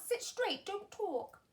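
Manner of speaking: high, stern classroom order; strict teacher. A narrator's reading. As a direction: keep the class in line. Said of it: "telling the class".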